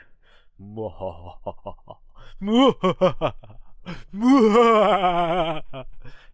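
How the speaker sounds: over-the-top evil cackle, high and loud; evil laugh. Shown as a demonstration. Demonstrating the evil laugh game, hammed-up villain cackle. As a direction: cackle like a cartoon villain, as big as you can. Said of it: "Do your best impression of an evil character's laugh".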